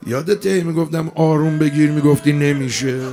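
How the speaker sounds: loud, ringing lament for the wounded imam; full-voiced grieving preacher; impassioned mourning sermon. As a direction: cry it out to the mourners.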